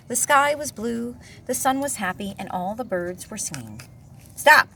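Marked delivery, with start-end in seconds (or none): 4.3-4.7 loud barked stop; growled. A narrator's reading. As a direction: snap it like a grumpy bear. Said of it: "growled Bear".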